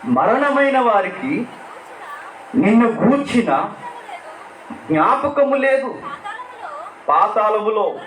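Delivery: high, drawn-out psalm recital; solemn scripture reading. Acted. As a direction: intone the verses like a prayer. Reads psalm aloud in prayer.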